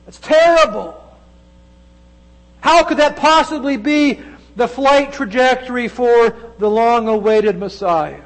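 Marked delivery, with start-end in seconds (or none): 0.1-5.9 dismayed outcry. Acted. Peter's dismay at the prophecy.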